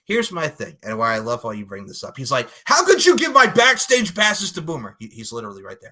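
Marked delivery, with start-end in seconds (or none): 2.6-4.9 loud, high-pitched mock outrage; outraged retelling. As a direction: blurt it out as an indignant imitation. Acts out outraged complaint from show.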